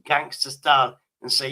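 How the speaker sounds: usual speaking voice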